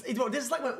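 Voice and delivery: ordinary delivery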